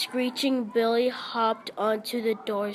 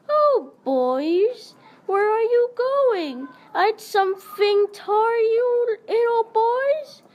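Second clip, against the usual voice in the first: much higher put-on girlish voice; shrill girlish voice; 11 semitones higher, 4 dB louder than usual. Billy calling after the fleeing boys in a girlish voice. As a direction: tease the boys as a silly girl. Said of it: "called in a shrill, girlish voice".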